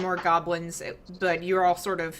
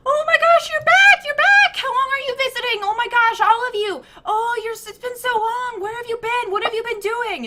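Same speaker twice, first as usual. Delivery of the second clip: put-on very high, excited child's squeal; squealing little girl; 9 dB louder than usual. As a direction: gush like a thrilled kid seeing old friends. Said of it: "And Alice is squealing".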